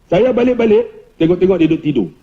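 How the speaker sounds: usual speaking voice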